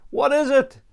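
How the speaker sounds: ordinary delivery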